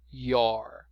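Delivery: your said as one syllable, "yor"; pronunciation demo. Shown as a demonstration. Teacher repeating the contraction for students, careful. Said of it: "Presta atenção na pronúncia que muda".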